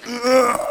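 high effortful "ugh"; mock groan of forcing yourself; straining groan. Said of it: "forcing yourself to".

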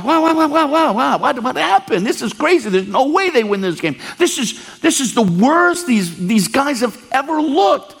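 high, stunned repeated wows; retold dismay, pitched high; shocked disbelief. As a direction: sound like you can't believe the halftime score. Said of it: "I'm like".

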